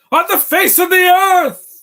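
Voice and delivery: theatrical villain. Retold or imitated. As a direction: belt it out like a melodramatic cartoon villain. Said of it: "he's so theatrical".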